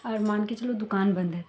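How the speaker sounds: ordinary talk, nothing marked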